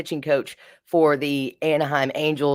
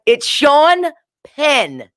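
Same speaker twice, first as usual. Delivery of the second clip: high, loud, drawn-out retort imitating a girlfriend; exasperated correction; 11 semitones higher, 5 dB louder, much slower than usual. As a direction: stretch each word in appalled emphasis. Girlfriend correcting her in exasperated disbelief.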